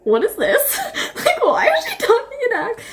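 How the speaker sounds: re-enacted high, giggly bafflement; laughing disbelief. Vlogger re-enacting her reaction to the audiobook accent, laughing disbelief. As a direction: crack up while questioning it, voice shooting way up. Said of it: "My little reaction was the".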